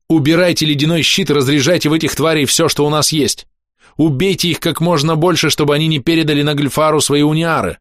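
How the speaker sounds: rally the comrades urgently; urgent command. Acted. Hero orders comrades to destroy the monsters, urgent calling.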